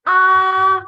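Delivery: AH held long on a high, level pitch; sustained high level AH; first tone. Shown as a demonstration. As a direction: stretch AH out loud as a flat high line. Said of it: "a flat line on your syllable".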